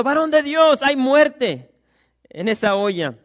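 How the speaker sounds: high, very loud shouted alarm; full-voiced cry from a Bible story. A narrator's reading. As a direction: shout the warning out to the prophet. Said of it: "gritaron diciendo".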